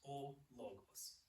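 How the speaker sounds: ordinary talk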